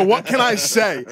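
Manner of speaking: put-on high, slow Portuguese-accented showman; old Portuguese accent. Old Portuguese customer quoting his line, drawn-out accent. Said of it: "hits me with one of these".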